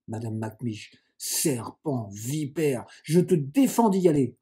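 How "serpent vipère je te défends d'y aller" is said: angry outburst that swells; enraged prohibition. Acted. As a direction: forbid it furiously, louder on the order. Cousin insults and forbids, enraged.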